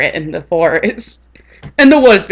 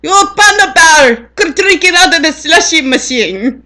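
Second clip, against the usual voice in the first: mock Indian accent; 9 semitones higher, 3 dB louder than usual. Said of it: "he'd be like".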